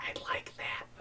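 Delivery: soft breathy whisper of the line. Retold or imitated. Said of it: "[name], He's like, I like that".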